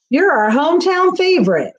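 cheering holler from the crowd. A narrator's reading. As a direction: call it out to the racer. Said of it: "hollered the home prize".

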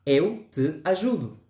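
"Eu te ajudo" slow, in a Brazilian accent; Brazilian Portuguese. Shown as a demonstration. Teacher modelling the Brazilian version for learners, in a Brazilian accent. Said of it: "la misma que en el portugués de Brasil".

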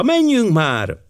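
high, eager urging; exclaiming. A narrator's reading. Girl hurrying the colonel ashore, crying out eagerly. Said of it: "kiáltotta".

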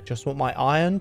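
ordinary talk

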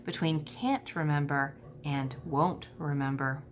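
soft, gentle, low-key correction; gently. A narrator's reading. Stella gently coaxing Ivan to remember, soft and tender. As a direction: ease the truth in tenderly. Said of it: "Stella says gently".

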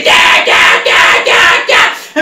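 tense breathy effort noises; straining grunts. Retold or imitated. Hanging from the rope, straining to let go. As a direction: push out each ya while hanging on.

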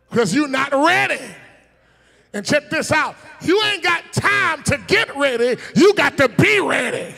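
loud, high shouted warning; preaching shout. Real, not acted. Preacher warning the church, loud shout.

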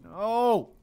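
frustrated no. Real, not acted. Gamer yelling at losing gear, dismayed. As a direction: blurt it out as everything is lost. Player loses gear in game.

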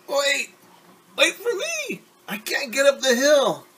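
high strained cry after her friends; a tired girl wailing to be waited for; calling out, winded. Acted. Ari lagging behind, calling out.

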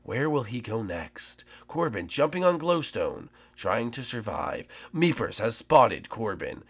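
slow, hushed nature-show narration; documentary narration. Acted. Player narrates Corbin's escape like a nature documentary. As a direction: narrate the chase like a wildlife film.

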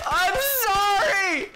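high, loud, slow cry of apology; drawn-out anguished shout; loud wail. Real, not acted. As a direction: wail sorry at the guy. Apologising to NPC while shooting.

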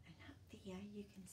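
fast hushed pointing-out; quick whisper. Acted. Pointing at the dream cloud.

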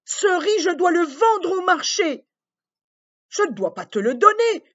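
high, loud indignant cry; girl's protest. Acted. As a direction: refuse the dragon boldly. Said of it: "répond Petite Sœur Lee". (petite fille qui proteste)

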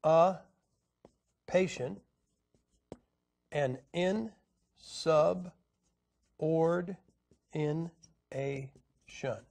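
dis, a, patient and in, sub, ord, in, a, shun, each syllable held apart. Shown as a demonstration. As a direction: break dissipation and insubordination into separate slow syllables with pauses. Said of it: "those are changed to dis".

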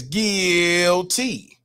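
GUILTY stretched into two long, separate syllables; drawn-out verdict. Pretend. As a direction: draw out "guilty" slowly, splitting GUIL and TEE. Mock verdict read out from chat.

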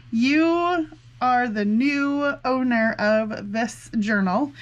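ordinary talk

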